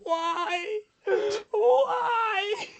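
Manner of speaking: high wailing shout, near crying; anguished cry. Acted.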